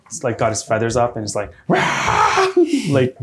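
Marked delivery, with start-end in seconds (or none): 1.7-2.5 high cockatrice screech; angry creature squawk. Acted. Cockatrice with its feathers up, high angry squawk. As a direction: squawk in outrage like a riled bird. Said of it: "really angry now".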